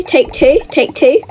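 normal talking voice

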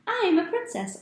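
high, bright, joyful shout; excited girl. Acted. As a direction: sing it out like a thrilled little girl.

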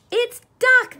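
very high, loud delighted shriek. A narrator's reading. Cat and squirrel spotting duck at home, shrieked. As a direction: shriek it out in excitement. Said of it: "they shrieked".

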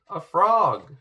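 a princess crying out at a frog; alarmed cry. A narrator's reading. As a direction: blurt it out in shock. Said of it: "she cried".

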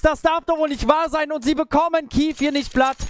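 rising hype, shouting the ace; excited caster losing it over a clutch; esports caster. Real, not acted.

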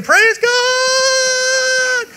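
high, loud church shout; hammed-up ecstatic praise yell; mock shout of praise. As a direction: shout it out like a sister running the aisle. Sister running aisle screaming praise.

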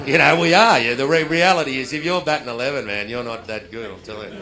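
loud rueful admission. Retold or imitated. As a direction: admit the truth with a laugh. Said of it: "And then I went".

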